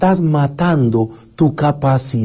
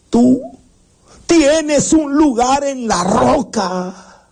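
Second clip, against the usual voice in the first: slow, solemn father's command, raised pitch; Mufasa; 5 semitones higher, slower than usual. Acted. Father urging his son to take his place as king, slow and solemn. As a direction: proclaim it in drawn-out phrases with long pauses. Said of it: "de Mufasa, que le dice".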